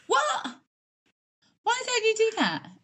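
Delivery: high, laughing, incredulous; she's stunned and amused; laughing disbelief. Real, not acted. Friend reacts to rare coffee habit, high and incredulous. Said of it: "That just really shocked me".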